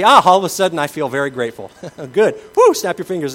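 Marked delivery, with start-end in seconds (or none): 0.0-1.7 mock instant gratitude. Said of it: "snap your fingers and say".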